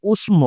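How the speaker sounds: উষ্ম with the ম sounded directly; উষ্ম: say the ম clearly, Sanskrit style; Sanskrit pronunciation. Shown as a demonstration. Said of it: "সংস্কৃত ব্যাকরণ অনুযায়ী উচ্চারণটা হচ্ছে". (সংস্কৃত রীতিতে উষ্ম উচ্চারণ)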